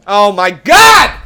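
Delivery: slow, drawn-out loud cry; exasperated stretched shout; loud dismay. Real, not acted. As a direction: yell it at the screen. Another goal against.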